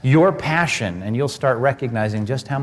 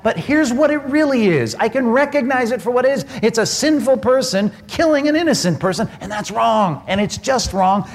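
preaching at full force; 7 semitones higher than usual. Acted. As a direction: preach it hot and fast.

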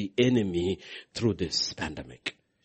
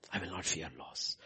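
much softer, lower echo of the vow; hushed repeat; 7 semitones lower, 14 dB quieter than usual. Real, not acted. Preacher to congregation, hushed vow of faith. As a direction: drop it to a quiet vow.

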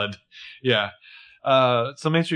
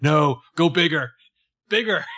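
urgent, drawn-out push, pitched up; mock hype-man goading the room for more; egging them on; 4 semitones higher, 5 dB louder than usual. Imagined writer egging the room on.

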